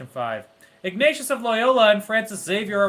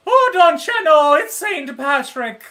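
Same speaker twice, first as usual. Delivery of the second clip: hammy, higher Irish brogue, deliberately terrible; a cheesy stage-Irish lilt, slowed down; bad Irish accent; 8 semitones higher, 7 dB louder than usual. Irish accent for a saints trivia answer, hammed up on purpose. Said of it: "that is the worst Irish accent I have heard".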